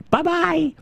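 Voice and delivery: high, chirpy mock goodbye; breezy squeaked send-off; dismissive farewell. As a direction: toss off a very high-pitched bye-bye. Acts out waving off other games.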